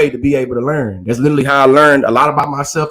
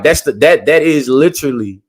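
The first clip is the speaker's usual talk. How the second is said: normal talking voice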